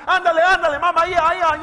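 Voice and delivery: high, loud Spanish cheer; urging on. Retold or imitated. Herding the bird to the door, a loud high cheer. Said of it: "and I'm like".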